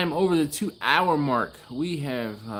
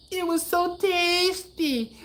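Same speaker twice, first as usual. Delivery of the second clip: high mock Trinidadian voice of the kid taster; Trinidadian accent imitation; slower than usual. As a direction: ham up a Trinidadian child's delight. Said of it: "I do a horrible Trinidadian accent".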